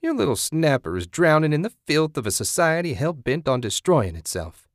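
high, swooping righteous tirade, falling at the end; sanctimonious outrage, hitting words hard; condemning zealot. Acted. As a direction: rail like a fanatical moralist.

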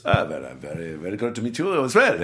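put-on a light German accent, courteous and measured; lightly put-on German accent, gracious old actor. Anthony Hopkins greets the young actor, light German accent. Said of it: "he has this German accent".

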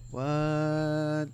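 confusión. Retold or imitated. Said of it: "que tú después dices".

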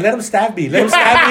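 high, laughing mock bravado; joking about the sword fight, laughing. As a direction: joke it through laughter.

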